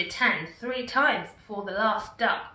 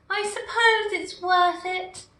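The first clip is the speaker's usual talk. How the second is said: lightly voiced Gumboot; 9 semitones higher, 4 dB louder than usual. A narrator's reading. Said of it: "said Gumboot as he took aim".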